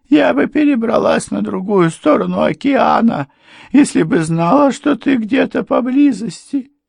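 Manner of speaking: very high, loud reproach; distraught wife. A narrator's reading. Wife rebukes long-lost husband, high distraught cry. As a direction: cry it out at the top of the voice.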